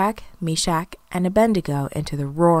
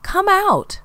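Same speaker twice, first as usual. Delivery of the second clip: calling out; 10 semitones higher, 5 dB louder than usual. A narrator's reading. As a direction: call each name across a distance. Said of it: "the king called".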